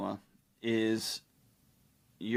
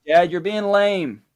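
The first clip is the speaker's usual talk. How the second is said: put-on high, louder, slow child's protest; daughter complaining; 8 semitones higher, 9 dB louder, slower than usual. Daughter to distracted dad, high, louder and slow. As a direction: whine it at Dad. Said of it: "she doesn't know how to say".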